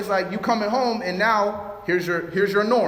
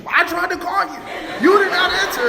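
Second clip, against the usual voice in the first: much higher, much louder indignant shout; frustrated outburst; 11 semitones higher, 5 dB louder than usual. Retold or imitated. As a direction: explode at the wife over the unanswered call. Retold quarrel, husband blowing up.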